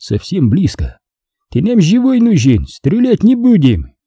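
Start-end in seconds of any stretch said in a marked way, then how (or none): 1.5-3.9 high voice pitched across distance; high-pitched hail to an enemy; calling out. A narrator's reading. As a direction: coax him, calling from cover. Opponent calling out from the bushes.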